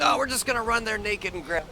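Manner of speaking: lightly high cartoon voice; high character voice. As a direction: answer in a squeaky game voice. Answering in character voice.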